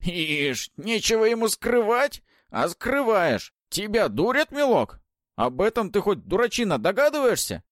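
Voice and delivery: высокий громкий насмешливый старушечий голос; поддразнивай, как хитрая ведьма; голос Бабы-Яги. Acted. Баба-Яга насмехается. (насмешливый голос ведьмы)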